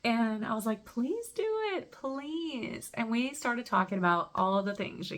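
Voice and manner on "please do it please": retold coaxing plea in a high voice; eager pleading. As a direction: plead sweetly way up high, softening on the last word. Retelling her plea to a woman.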